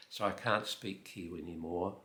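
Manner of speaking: usual speaking voice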